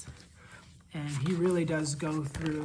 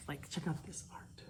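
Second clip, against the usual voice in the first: hushed whisper over the page; 14 dB quieter than usual. Real, not acted. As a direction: breathe it like a secret. Showing the book's art.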